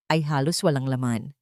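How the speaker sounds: ordinary delivery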